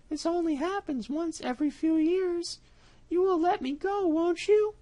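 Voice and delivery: high cat voice, sweetly lying; sly cat, coaxing. Acted. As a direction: charm the mouse into letting him go. Cat coaxes mouse.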